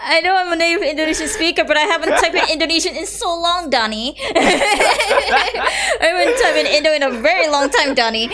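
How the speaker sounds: high, fast, laughing protest; laughing defense. Real, not acted. Teacher defends herself to the teasing student, laughing. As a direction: plead your case through giggles.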